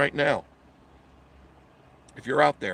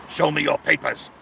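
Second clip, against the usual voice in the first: loud, raised officious order; mock official demand; 4 semitones higher, 6 dB louder than usual. Host imitating official demanding papers, loud and commanding. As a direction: bellow it like a checkpoint official.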